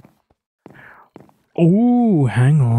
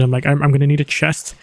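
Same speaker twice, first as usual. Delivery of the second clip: ordinary talk, nothing marked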